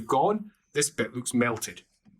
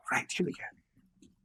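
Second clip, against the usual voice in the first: breathy whispered relief; relieved whisper; 8 dB quieter than usual. Acted. Lucas, relieved to drop his bags, whispering. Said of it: "Lucas goes".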